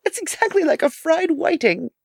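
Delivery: high, giggly mocking girl; tittering at someone's expense; kid giggling. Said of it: "giggled the girl".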